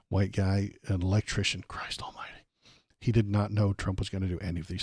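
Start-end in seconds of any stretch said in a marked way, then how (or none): 1.7-2.3 muttered under the breath, almost voiceless; exasperated, very soft mutter; exasperated aside. Real, not acted. Exasperated aside while reading profile.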